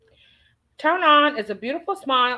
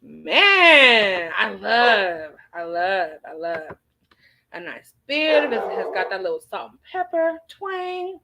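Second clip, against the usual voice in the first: ordinary talk, nothing marked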